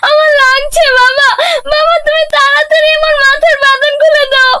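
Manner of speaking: high-pitched pained wail; tearful child's high plea; crying. Acted. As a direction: cry out to your father in pain. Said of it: "মেয়েটা কাঁদছে".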